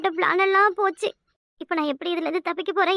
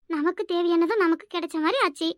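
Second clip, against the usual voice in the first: normal talking voice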